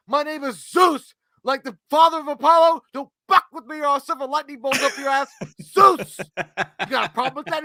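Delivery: high, loud threatening rant; menacing rant. Retold or imitated. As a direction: threaten him, hammed up. Zeus threatening Bruce Willis.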